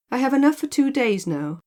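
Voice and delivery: a hint of the airy man; lightly voiced carefree man. A narrator's reading. Man accepting the milk, lightly voiced and breezy.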